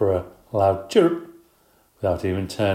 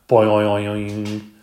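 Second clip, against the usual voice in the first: a drawn-out loud boing noise; 6 dB louder than usual. Acted. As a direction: make a big springy boing sound. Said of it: "we kept up the noise".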